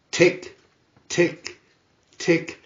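crisp clock ticks; ticking clock. A narrator's reading. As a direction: tick tock like a clock.

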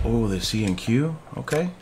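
ordinary talk

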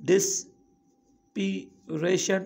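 ڊسپيريشن in three slow, separated chunks; long pauses inside ڊسپيريشن, each part on its own; syllable by syllable. Shown as a demonstration. Teacher showing learners the syllables, slowly one by one. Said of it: "ٽي syllabus ٺهي آهي".

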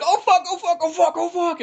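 high, frantic mock panic, repeated curses; panicked yelling. Friend fleeing a swooping owl, mock panicked yelling. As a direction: squeal it out like you're fleeing an owl.

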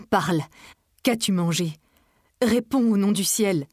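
loud, urgent begging; desperate plea. A narrator's reading. Charles kneeling begs Emma to speak, desperate loud plea. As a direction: shout at her to answer.

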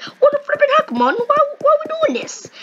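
put-on high-pitched comic accent; Drippy. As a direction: mimic a game fairy's hilarious accent, very high. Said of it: "it's hilarious how he would speak".

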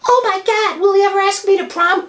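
put-on high squeaky girl voice, ending in a shout; girly voice. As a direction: play a teen girl who screams no. Girl voice asked to prom.

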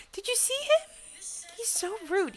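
high-pitched indignant squeal; indignant complaint. Real, not acted. Gamer complaining about a rude rival, high-pitched indignation. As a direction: pitch it way up, scandalised.

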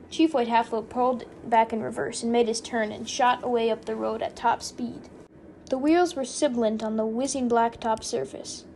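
usual speaking voice

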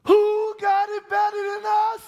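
slow, high, full-force shout; rally the congregation with a shout; preaching call. Acted.

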